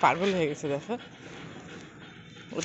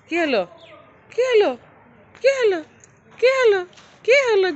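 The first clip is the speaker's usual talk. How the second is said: rising, louder sing-song calls to the pet; high coaxing call; 12 semitones higher, 7 dB louder than usual. Real, not acted. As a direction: coax the pet with a high eager voice. Owner coaxing pet, pitch climbing.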